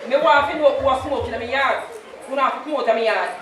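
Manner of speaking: rapid-fire patois telling-off; hurried imitation of a strict mother; fast mock scold. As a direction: rattle off Mama's lecture quickly. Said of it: "She said".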